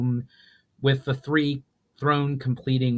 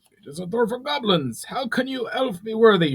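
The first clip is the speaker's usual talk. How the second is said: much higher thin goblin voice, rising to a shout; 12 semitones higher, 4 dB louder than usual. A narrator's reading. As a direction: challenge the elf's worth. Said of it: "shouted a tall and thin goblin".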